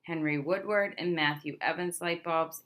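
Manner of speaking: ordinary talk, nothing marked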